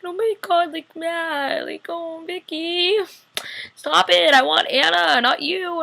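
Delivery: high, emotional mock whine; teary-eyed pleading. Viewer begs Vicky to stop, high teary pleading. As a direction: plead as if about to cry. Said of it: "my eyes got all watery".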